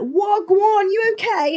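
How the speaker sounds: high, loud, overeager greeting; English person. Retold or imitated. English person greeting a Caribbean, high loud mimicry. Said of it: "the most English person will come up to you".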